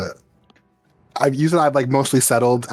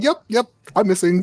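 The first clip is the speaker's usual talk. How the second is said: enthusiastic; 9 semitones higher than usual. Retold or imitated. Retelling player's eager line.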